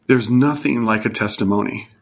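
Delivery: normal talking voice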